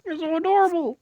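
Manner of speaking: high, loud squeal of delight; gushing. Retold or imitated. Grabbing Kobani's face, gushing in a high loud squeal. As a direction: squeal over how adorable she is. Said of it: "I just grab her face".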